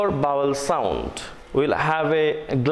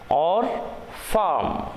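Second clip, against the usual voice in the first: American accent. Shown as a demonstration. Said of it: "In American accent".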